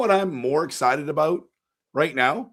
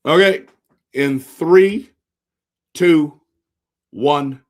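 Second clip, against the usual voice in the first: excited countdown; 2 semitones higher, 9 dB louder, slower than usual. Real, not acted. Streamer counting down the draft lottery to his chat, loud and excited. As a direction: call out the countdown.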